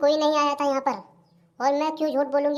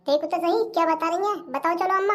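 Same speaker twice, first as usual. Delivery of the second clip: normal talking voice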